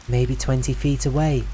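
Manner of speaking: ordinary delivery, nothing marked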